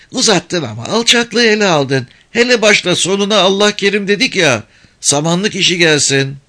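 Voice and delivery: put-on high, lively little village girl; kız çocuğu sesi. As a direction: play a cheeky young girl. Girl scolds. (tiz sesli köylü kız)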